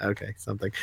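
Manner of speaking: low, very soft, hesitant reaction; underwhelmed muttering. Retold or imitated. Reader reacting to pages of grunts, underwhelmed muttering. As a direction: trail down into a doubtful murmur.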